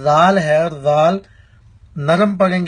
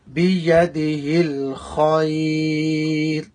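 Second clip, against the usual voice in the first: slow, measured Arabic recitation; much slower than usual. Shown as a demonstration. Teacher reciting the kalima for students, slow measured recitation. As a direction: recite each phrase slowly and deliberately.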